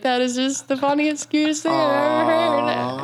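high, slow put-on voice; recalling her reaction to a silly excuse; mock amused reaction. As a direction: marvel at it theatrically. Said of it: "I was like".

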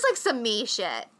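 normal talking voice

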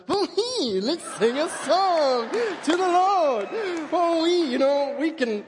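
high, loud, sing-song churchy cheer, slowed. Retold or imitated. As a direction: parody a relentlessly cheerful believer. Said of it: "people are like".

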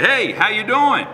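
lightly voiced dad calling in; dad's greeting. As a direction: call it out like a cheerful father. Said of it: "he'd say".

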